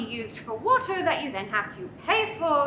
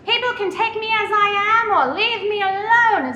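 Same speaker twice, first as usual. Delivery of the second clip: imitating Nan; 8 semitones higher, 8 dB louder than usual. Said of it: "one of her favorite sayings".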